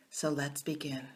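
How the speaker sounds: low, soft start cue. Real, not acted. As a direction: ease in quietly. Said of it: "soft cue to start meditation".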